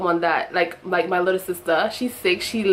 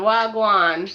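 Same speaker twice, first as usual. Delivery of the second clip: drawn-out Jamaican patois greeting; 4 dB louder than usual. Shown as a demonstration. Big sister correcting little sister's American answer, Jamaican patois. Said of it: "Say it to the Jamaican".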